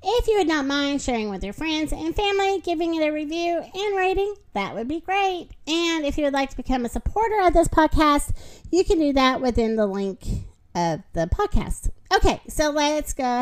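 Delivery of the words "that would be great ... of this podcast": usual speaking voice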